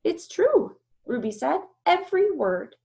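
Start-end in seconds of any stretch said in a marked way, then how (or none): 0.0-0.8 high, loud firm insistence; young elephant voice insisting; Ruby insisting. Acted. 1.9-2.8 high, loud firm insistence; young elephant voice insisting; Ruby insisting. Acted.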